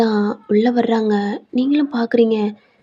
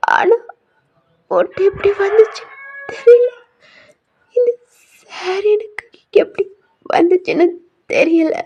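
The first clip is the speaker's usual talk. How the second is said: high, sobbing denial; accused bride denies the saree to her mother-in-law, sobbing high; crying protest; 9 semitones higher, 4 dB louder than usual. Acted. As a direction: protest your innocence through tears. Bride wrongly accused, breaks down crying.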